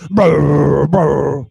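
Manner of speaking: detector buzz. Retold or imitated. Imitating the detector noise.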